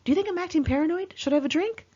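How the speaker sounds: high, rushed paranoid questions; imitating paranoid friend. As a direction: rattle it off like a jittery friend.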